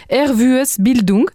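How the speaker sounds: Ervues Bildung said slowly in careful Luxembourgish; Luxembourgish pronunciation. Shown as a demonstration. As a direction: Ervues Bildung pronounced Luxembourgish style, slow. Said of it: "excusez-moi pour ma prononciation luxembourgeoise".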